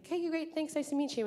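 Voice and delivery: high, polite and careful; respectful nervous reply. Retold or imitated. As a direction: play it politely, a little too high.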